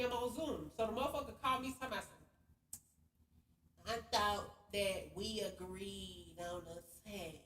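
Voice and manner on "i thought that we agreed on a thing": slow, soft, prissy put-on voice; sassy man impression. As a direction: mimic him drawing out his words, sassy. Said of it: "He, he's sassy".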